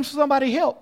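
acted scream for help. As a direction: call out for help, not full scream. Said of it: "Josh would scream".

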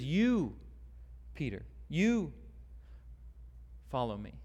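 single out Peter on "You", then drop; emphatic You. Shown as a demonstration. Preacher voices Jesus singling out Peter, emphatic. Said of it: "And so Jesus says".

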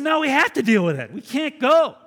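put-on young men loudly insisting to the pastor. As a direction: refuse to leave, raising the voice.

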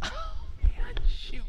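breath-only muttered letdown; whispered dismay. Acted. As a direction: whisper it as if you just blew it. Deflated reaction to the complaint.

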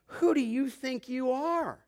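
high, slow taunting question; mocking challenge. Preacher repeating the crowd's words to Jesus, mocking. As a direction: draw it out as a scoffing challenge. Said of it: "They're mocking him".

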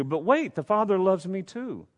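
hammed-up voice of a listener objecting; mock objection. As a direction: butt in like a jealous child. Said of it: "We don't need to move quickly into".

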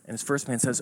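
normal talking voice

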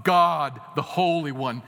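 loud, drawn-out holy pronouncement; put-on grand aura. Shown as a demonstration. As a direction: boom out the divine name with solemn weight. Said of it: "if you talk about".

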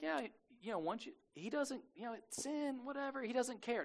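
high, soft, imitating someone who shrugs it off; breezy dismissal. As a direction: wave it away lightly, pitch lifted. Mimics a lax view of sin.

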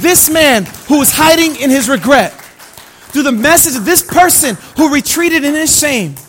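loud, high, bold sermon climax; full-force preaching. Acted. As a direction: preach it out to the whole church.

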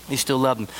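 usual speaking voice

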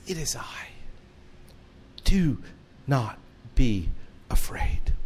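hushed, tender comforting voice; kind, caring reassurance. Retold or imitated. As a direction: reassure them gently, word by word. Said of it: "any more kind, caring, and loving words".

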